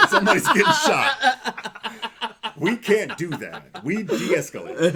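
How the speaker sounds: high, loud and slow, laughing through it; laughing punchline. Real, not acted. Firefighter laughing at his joke about cops, loud and slow. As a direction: laugh the words out slowly.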